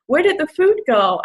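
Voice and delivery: mock bewilderment. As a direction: act out mild surprise at your own plate. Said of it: "and I'm like".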